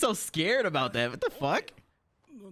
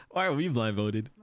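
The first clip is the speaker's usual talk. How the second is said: normal talking voice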